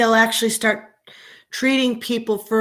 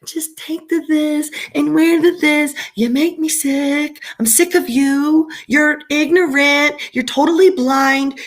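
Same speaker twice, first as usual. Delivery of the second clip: mocking the scolders; 5 semitones higher than usual. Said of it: "how y'all can just be like".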